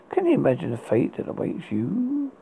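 usual speaking voice

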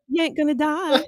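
high, unbothered West Virginia catchphrase; Meg O'Death. Retold or imitated. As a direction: toss it back in a West Virginia twang. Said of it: "she's got this West Virginia accent".

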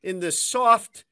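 slow, high Boston grumble; Boston accent. Acted. Fans blaming the media, slow high Boston accent. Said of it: "I went Boston accent there for a second".

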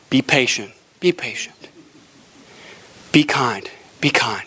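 low, deep instructions from God. Retold or imitated. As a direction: intone like a calm, low God. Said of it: "the still small voice of God".

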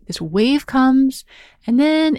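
slow, lifted phrase; rising wave. Shown as a demonstration. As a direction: lift the voice like a cresting wave.

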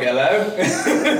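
a laughing, put-on British accent on "Hello?". Answering Molly's call at the restaurant, mock British accent. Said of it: "Did you just answer the phone in a British accent?".

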